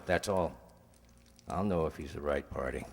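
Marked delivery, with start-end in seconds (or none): none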